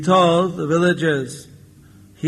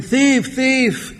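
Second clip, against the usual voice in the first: mock shouting; 7 semitones higher than usual. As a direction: imitate villagers raising a cry from afar. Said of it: "they all be shouting".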